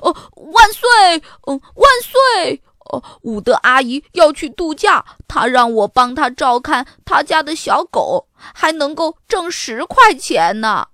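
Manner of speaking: loud, high cheering, then excited telling; elated. A narrator's reading. Boy tells sister about his first job, elated. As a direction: burst out cheering, then share the news loudly. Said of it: "他眉飞色舞地对多拉说".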